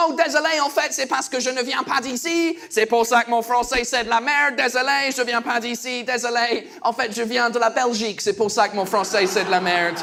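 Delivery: French with a put-on English accent; fake English accent. Apologising to the baker, fake English accent. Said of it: "j'ai repris un faux accent".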